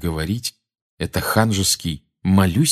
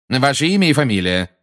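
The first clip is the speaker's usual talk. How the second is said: raised, official demand; loud demand; 6 semitones higher, 4 dB louder than usual. A narrator's reading. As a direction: demand the witness's details. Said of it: "громко потребовал Фадж".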